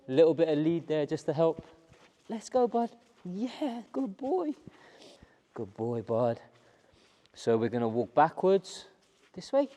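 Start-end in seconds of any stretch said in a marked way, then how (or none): 2.3-6.4 high, encouraging praise for a dog. Real, not acted. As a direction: cheer the dog on, much higher. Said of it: "that nice tone of voice".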